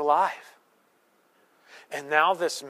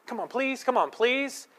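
put-on childish begging; 8 semitones higher than usual. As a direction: wheedle like a small kid. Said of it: "Like a child".

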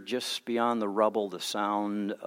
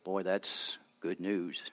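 softer, slow relieved exhale; sigh of relief; 3 semitones lower, 9 dB quieter, slower than usual. Retold or imitated. Rescued person sighing to rescuers, soft and slow relief. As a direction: let the words out like relief. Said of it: "take a sigh of relief and say".

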